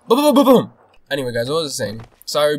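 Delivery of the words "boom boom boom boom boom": very high, loud booming burst; triumphant high-pitched booms; victory gun noises. Pretend. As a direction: celebrate with rapid mouth gunfire. Celebrates the kill with gun noises.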